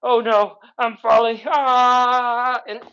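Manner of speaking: high, hammed-up cry of someone tipping over; playful pretend fright, voice climbing high; mock falling panic. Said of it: "you start going".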